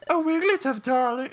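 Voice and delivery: very high-pitched put-on darling voice; high, affected character squeal; almost the Goodyear voice. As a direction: pitch it way up for a cooed greeting. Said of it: "that was almost like my Goodyear voice".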